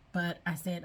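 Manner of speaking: normal talking voice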